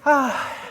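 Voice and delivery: high, loud sigh after laughing. Real, not acted. Recovering from laughter.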